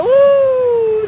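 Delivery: high, loud whooping hoot; long, high excited woo. Retold or imitated. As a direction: whoop like a cheering crowd. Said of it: "I was like".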